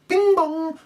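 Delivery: mock alarm chime. Laptop alarm sound imitated.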